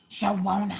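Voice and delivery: high sing-out of the name. Shown as a demonstration. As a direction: draw the name out like a pop star. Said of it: "say it like [name]".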